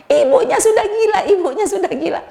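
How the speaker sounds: high, frantic cry for help; alarmed calling out. Retold or imitated. Nurse running out of the room, crying out in alarm. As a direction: yell the news down the corridor.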